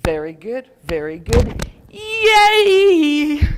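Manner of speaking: very good, very good, then a high loud yay; wrap-up cheer. Shown as a demonstration. Teacher shows the cheer that ends a laughter round. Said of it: "we go".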